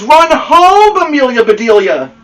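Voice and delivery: loud, high urgent call; shouting. Acted. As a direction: shout after the runner. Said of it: "shouted the boys".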